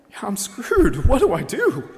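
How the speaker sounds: high-pitched helpless dismay, played for laughs; hammed-up cry of being doomed; mock panic. Isaiah approaching a holy God, comic panic.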